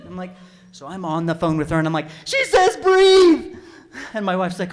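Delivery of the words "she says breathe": very loud, high, stretched-out yell; panicked shout. Retold or imitated. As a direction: bellow the instruction in a panic. Panicked relay to wife.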